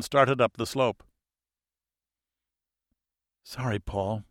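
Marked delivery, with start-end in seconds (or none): 3.4-4.2 soft, hushed apology; whispered. A narrator's reading. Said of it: "Game whispered".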